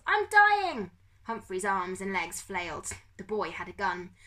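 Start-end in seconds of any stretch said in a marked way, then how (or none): 0.1-0.9 high, very loud wail; drawn-out wail. Acted. Pickers believing he is dying, wailing. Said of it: "Pickers wailed".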